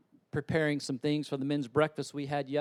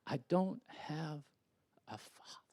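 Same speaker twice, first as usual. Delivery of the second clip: hushed retelling; 7 dB quieter than usual. Retold or imitated. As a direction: voice fading to a whisper. Fatherless boy's words retold.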